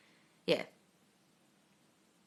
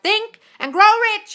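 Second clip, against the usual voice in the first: much higher and louder, triumphant; proud reveal; 14 semitones higher, 11 dB louder than usual. Retold or imitated. Author announces his title to the publisher, triumphant. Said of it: "He's like".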